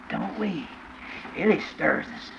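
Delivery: normal talking voice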